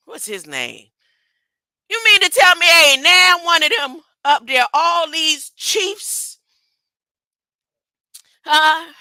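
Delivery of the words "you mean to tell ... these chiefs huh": louder, higher challenge; loud, higher indignant challenge; indignant call-out. Real, not acted. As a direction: call them out in disbelief.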